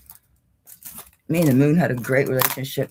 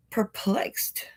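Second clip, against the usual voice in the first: whispered; 4 semitones higher, 6 dB quieter than usual. Real, not acted. Word said breathily.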